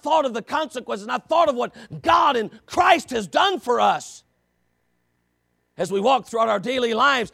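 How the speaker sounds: loud, rising preacher's call; fiery pulpit preaching; preaching at full force. Acted. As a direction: build the questions to a shout.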